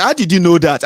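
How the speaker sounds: loud startled question; loud surprised question. Retold or imitated. Asking his wife about the score, loud surprise. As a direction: blurt it out amazed. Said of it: "I said".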